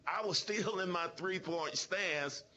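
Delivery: ordinary talk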